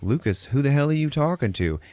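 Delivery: retold mom pressing her son; mother's insistent question. Mom confronts son talking to nobody, mock insistent.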